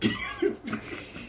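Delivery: high breathless wheeze of a laugh; squealing silent laugh. Real, not acted. Teller cracking up at his own story, breathless wheezing laugh. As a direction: gasp with laughter, almost no voice.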